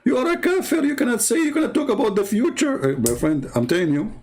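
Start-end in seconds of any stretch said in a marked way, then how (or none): 0.0-2.9 high squeaky indignant voice; mocking caricature. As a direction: parody an outraged believer.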